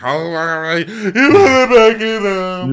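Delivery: high, loud, drawn-out comic wail; mock wail. Acting out a drink coming back up, loud mock wail. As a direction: wail it high and stretched, half laughing.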